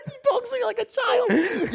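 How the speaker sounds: laughing through it, pitched high; real laughter breaking the words. Real, not acted. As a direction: squeal the complaint through laughter. Laughing complaint.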